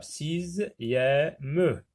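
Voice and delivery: "Sixième" in three beats, si-ziè-me; "Sixième" syllable by syllable; split into syllables. Shown as a demonstration. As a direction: sound out "Sixième" slowly, ending on a full -me. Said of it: "trois syllabes".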